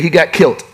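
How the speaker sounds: "kilt" said with a short i and a hard t, in place of killed; country slang, "he got kilt", with a grin; country pronunciation. Shown as a demonstration. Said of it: "as we say in the country".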